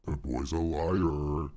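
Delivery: slow, drawn-out put-on voice; Pirate Software voice. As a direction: drag out each word in character. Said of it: "Let me get the Pirate Software voice going".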